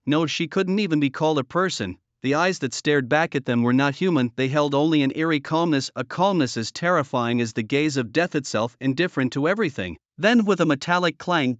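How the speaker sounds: ordinary delivery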